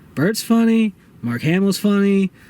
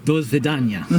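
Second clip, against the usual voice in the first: lower, mock Russian accent; 7 semitones lower than usual. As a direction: say goodbye like a hammed-up Russian. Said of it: "can you say that with a Russian accent".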